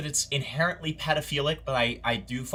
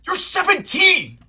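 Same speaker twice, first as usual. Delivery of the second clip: outraged shout; 8 dB louder, slower than usual. Shown as a demonstration. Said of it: "Is that better?".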